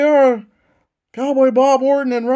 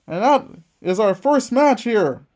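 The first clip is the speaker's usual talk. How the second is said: ordinary talk, nothing marked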